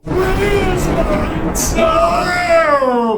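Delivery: loud, high, very drawn-out ghostly wail. Acted. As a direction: howl it slowly, stretching every word. Ghost wails for its toe.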